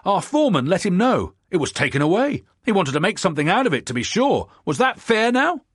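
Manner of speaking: loud, high eager telling; excited. Acted. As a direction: rush on, all worked up. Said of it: "the excited speaker went on".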